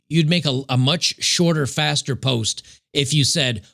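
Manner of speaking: normal talking voice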